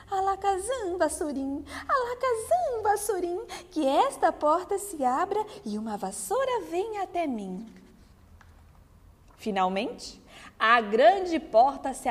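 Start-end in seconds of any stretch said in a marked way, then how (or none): 0.1-7.6 a fairy trying the spell; fairy casting spell. Acted. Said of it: "proferiu as palavras mágicas".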